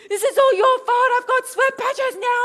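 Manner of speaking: loud, high-pitched tantrum; hammed-up whiny shouting; ultimate brat mode. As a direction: blame dad like a spoiled brat. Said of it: "ultimate brat mode".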